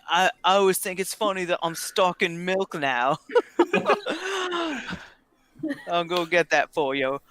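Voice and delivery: lightly voiced barkeeper; a hint of a put-on barkeep voice; changed character voice. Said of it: "What happened to your voice?".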